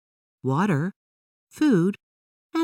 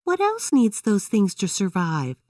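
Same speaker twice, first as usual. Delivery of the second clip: Emily's voice; 6 semitones higher than usual. A narrator's reading. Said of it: "Emily asked".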